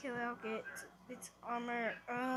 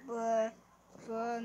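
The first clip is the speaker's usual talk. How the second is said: normal talking voice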